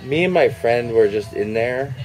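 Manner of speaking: ordinary talk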